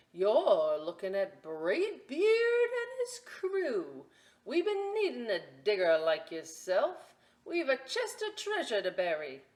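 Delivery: Braid Beard proudly introducing his crew; pirate captain. Acted. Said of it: "he said".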